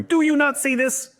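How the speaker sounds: high, loud exasperated driver; exasperated outburst. Acted. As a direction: cry out in road-rage disbelief. Choleric driver stuck behind, exasperated.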